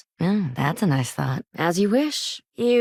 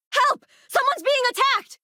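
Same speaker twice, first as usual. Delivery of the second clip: screaming for help. Acted. Bystander screams for help at a murder, panicked shout. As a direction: shriek for help as if witnessing a murder.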